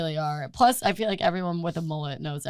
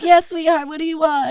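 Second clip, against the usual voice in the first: high, louder sweet server voice; sweet waitress voice; 8 semitones higher, 8 dB louder than usual. Retold or imitated. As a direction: play the chirpy waitress. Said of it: "She, she's like".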